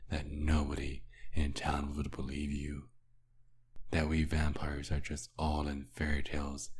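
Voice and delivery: ordinary talk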